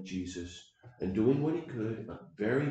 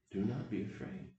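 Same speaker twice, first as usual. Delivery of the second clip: soft repeat; 9 dB quieter than usual. Shown as a demonstration. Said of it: "I'm gonna pass on to you".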